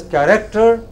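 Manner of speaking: character as ka-REK-ter; mistaken pronunciation. Shown as a demonstration. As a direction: stress REC in the middle of character. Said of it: "if you pronounce it as".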